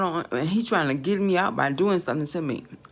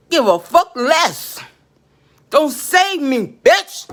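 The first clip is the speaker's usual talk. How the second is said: slow and loud, rising to a high shriek on bitch; building yell; 7 semitones higher, 9 dB louder, much slower than usual. Real, not acted. Cursing out the spirit, slow build to a furious yell. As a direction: build to a scream.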